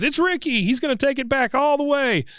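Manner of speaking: high excited play call; Hank calling the play. Retold or imitated. Hank tells Dale what he sees on the field: high excited call. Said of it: "Hank says".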